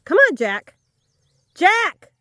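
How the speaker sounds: high, loud shout for the dog; yelling. Real, not acted. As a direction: yell the dog's name to call it back. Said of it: "Sorry, I yelled at you".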